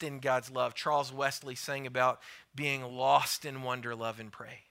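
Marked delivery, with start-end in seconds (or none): none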